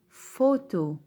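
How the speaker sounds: فوتو said slowly with a clear f; photo example. Shown as a demonstration. Teacher modelling the word photo for the class. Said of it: "زي كلمة إيه؟".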